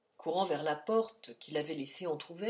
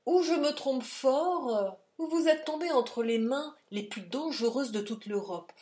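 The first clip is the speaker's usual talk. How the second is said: alarmed exclaim; 6 semitones higher, 4 dB louder than usual. A narrator's reading. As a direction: voice the danger gravely. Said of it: "s'écria le docteur". (avertissement alarmé)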